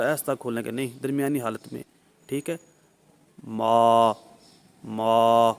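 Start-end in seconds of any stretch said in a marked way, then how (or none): 3.5-5.5 ما stretched one alif long; teacher demonstrating a lengthened ما, loud and low; drawn-out ma. Shown as a demonstration. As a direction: hold the vowel of ما for one beat. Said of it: "یعنی اس طرح پڑھیں گے".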